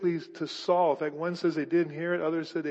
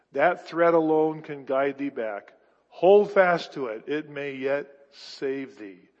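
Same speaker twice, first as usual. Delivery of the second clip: slow, weighty reading of a rabbi's charge; solemn exhortation; 4 semitones lower, 4 dB louder, slower than usual. Acted. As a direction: drag out each phrase, press, then let it settle. Resumes reading the novel.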